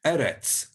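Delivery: Eretz with a tapped r and a crisp ts at the end; Eretz, stress on the first e, ts ending; Hebrew Eretz. Shown as a demonstration. Teacher models Eretz for learners. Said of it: "the line underneath it means a ts sound".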